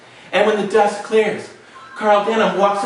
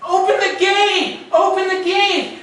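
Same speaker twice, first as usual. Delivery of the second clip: high, slow, shouted call; yelling; 8 semitones higher, slower than usual. Acted. Jack yells up to the man on the gate, slow high shout. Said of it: "and Jack yells".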